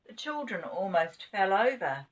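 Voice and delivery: plain reading voice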